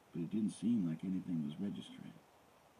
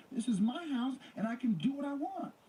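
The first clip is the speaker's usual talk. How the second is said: furious; 5 dB louder than usual. A narrator's reading. Nicole screaming at O J.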